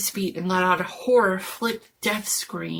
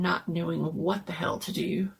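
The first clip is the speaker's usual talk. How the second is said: ordinary delivery, nothing marked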